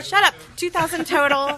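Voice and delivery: loud, high mock outrage; playful shout. Real, not acted. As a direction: yell it in playful protest. Playful outburst at friend.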